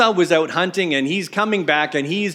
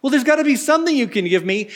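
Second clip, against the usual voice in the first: higher, faster desperate plea; begging Esau; 9 semitones higher, faster than usual. Acted. Esau begging Isaac for something, higher and fast. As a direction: grasp at anything left. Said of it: "Esau begging his father".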